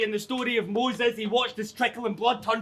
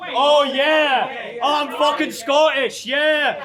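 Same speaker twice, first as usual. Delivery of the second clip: loud, raised-pitch hammed-up cheer; mock boasting shout; 4 semitones higher, 6 dB louder, much slower than usual. Battler playing up being Scottish to the crowd, mock boasting shout. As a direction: shout it like an over-the-top brag.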